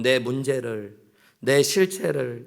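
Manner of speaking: ordinary talk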